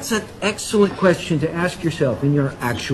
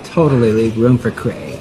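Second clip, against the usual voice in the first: mocking affected voice; 5 semitones lower than usual. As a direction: copy her put-on bored voice, lower. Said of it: "Why are you talking like that?".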